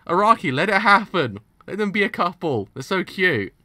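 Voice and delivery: high, very loud pleading; exasperated fan shouting. Real, not acted. Fan begs author to make them a couple, exasperated shouting. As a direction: wail it at full volume, tripping over words.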